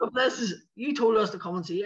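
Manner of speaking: high-pitched mock complaint; imitated protest. Audience member protesting to comedian, imitated indignant heckling. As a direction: play an aggrieved heckler.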